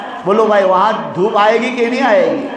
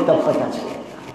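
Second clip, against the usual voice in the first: hushed aside; 9 semitones lower, 7 dB quieter than usual. Real, not acted. Preacher's aside to the students.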